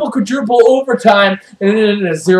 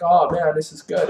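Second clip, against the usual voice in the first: low, soft, satisfied aside; acted contentment; 5 semitones lower, 8 dB quieter than usual. Chargers enjoying a hot dog mid-game, mock contentment. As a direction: savour it quietly, as if mid-snack.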